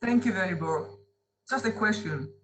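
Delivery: ordinary delivery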